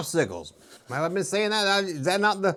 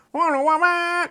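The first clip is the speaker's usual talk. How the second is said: TV theme call; 11 semitones higher than usual. Retold or imitated. A kid hearing the TV theme from the kitchen, called out high. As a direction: belt it out like the TV intro. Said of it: "you hear from the distance on your T V".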